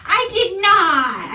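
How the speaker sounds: loud slow Tommy Wiseau shout; hammed-up Tommy Wiseau outburst, drawn out; Tommy Wiseau accent yelled slowly; mock Tommy Wiseau accent. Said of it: "try and copy his accent".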